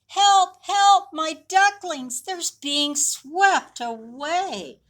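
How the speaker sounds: high, loud, slow desperate cry; Mommy Duck calling from the riverbank; frantic call for help. A narrator's reading. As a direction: shout across the river for help. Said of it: "Mommy Duck called".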